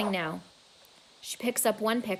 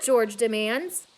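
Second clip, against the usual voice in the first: ordinary delivery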